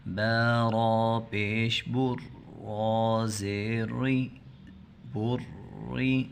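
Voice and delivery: slow, drawn-out recitation of each syllable; slow spelling drill. Shown as a demonstration. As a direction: spell it out for children to repeat. Teacher spells out the letters slowly. (آہستہ ہجے کی مشق)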